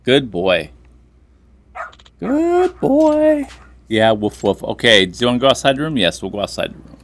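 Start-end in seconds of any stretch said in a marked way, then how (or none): 2.2-3.5 much higher, drawn-out sing-song praise; dog praise voice. Pretend. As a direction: gush over the dog like a proud owner.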